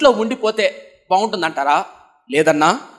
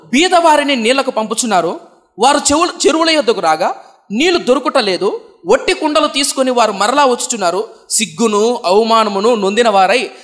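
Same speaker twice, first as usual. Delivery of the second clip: louder, solemn Bible reading; scripture reading; 2 semitones higher, 4 dB louder than usual. Shown as a demonstration. Preacher reading the second verse aloud, solemn and louder. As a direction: read the verse out with grave weight. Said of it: "ఇంకా రెండో వచనం చూద్దాం".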